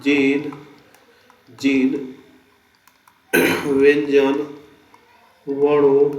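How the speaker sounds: word by word with long pauses for writing; रुक-रुककर लिखवाना; dictation pace. Shown as a demonstration. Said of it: "चलिए लिख देते हैं".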